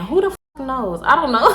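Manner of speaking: usual speaking voice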